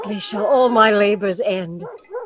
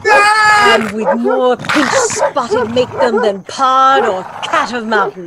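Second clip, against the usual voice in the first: louder, forceful orders; loud commands; 5 semitones higher, 5 dB louder than usual. Acted. Prospera ordering Ariel to torment the thieves, loud and forceful.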